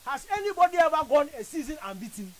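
ordinary talk

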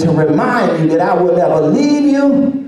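loud, high, soaring sermon climax; full-force preaching. Acted. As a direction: shout God's promise to the congregation.

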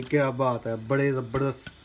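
normal talking voice

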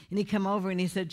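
ordinary delivery

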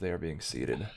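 normal talking voice

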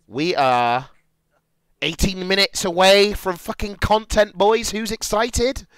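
rally the viewers with rising excitement; hyping the crowd. Real, not acted.

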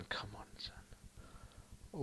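soft unvoiced plea; whisper. Real, not acted. Gamer whispering to his striker. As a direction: whisper it under your breath.

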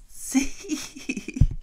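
short airy chuckle; breathy laugh. A narrator's reading. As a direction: let a little laugh slip out. Narrator laughs at the joke.

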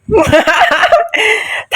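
high, falling burst of laughter; real high laugh. Real, not acted. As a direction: keep laughing at the blunder. Blew up his ally by accident.

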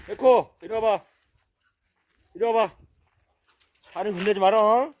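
loud, high telling-off of a dog; scolding call. Real, not acted. Owner scolding the dog that bullies another, loud and high.

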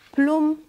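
loud, high "plum" splash; sudden comic splash sound; splash noise. A narrator's reading. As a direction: punch out a storybook plop. Imitating a splash into pool.

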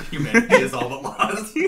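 high, loud cartoon villain, laughing; high villain proclamation through laughter; Plankton. Host repeating the first line on request, cartoon villain impression. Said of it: "read the first line again".

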